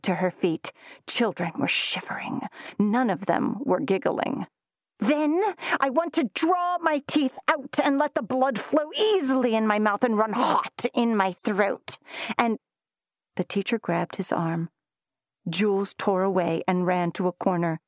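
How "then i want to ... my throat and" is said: ferocious reading. A narrator's reading. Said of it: "Jules read ferociously".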